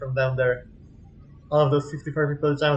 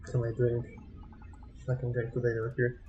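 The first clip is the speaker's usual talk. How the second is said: quiet nervous mutter; 3 semitones lower, 10 dB quieter than usual. Real, not acted. Nervous exploring alone.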